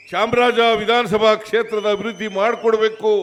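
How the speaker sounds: rousing oratory. Real, not acted. Speaker telling rally crowd about their candidate, loud rousing delivery. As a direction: build the speech to a rallying peak. Rally speech building to a climax.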